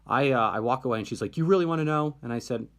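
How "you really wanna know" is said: higher, louder mock challenge; retold upset challenge. Upset student challenging her curious friend, retold upset and loud. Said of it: "she was really upset".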